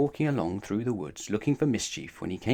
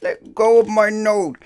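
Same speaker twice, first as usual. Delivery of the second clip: pained cry; 11 semitones higher, 11 dB louder than usual. Acted. Mister Mischief with his nose held by the wizard, pained cry. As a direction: yelp while your nose is held. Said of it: "cried Mister Mischief again".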